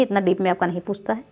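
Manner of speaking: ordinary talk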